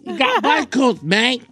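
slow, put-on British bloke; British accent. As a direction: play a lightly mocking Cockney mate. Mock English about bike thief.

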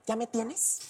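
ordinary talk, nothing marked

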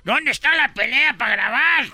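very high, excited calling out; mock eager crowd. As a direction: play an eager crowd hunting the action. Crowd rushing to film a fight.

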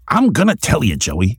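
hammed-up voice of a legendary comics man; Stan Lee impression. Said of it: "my Stan Lee impersonation".

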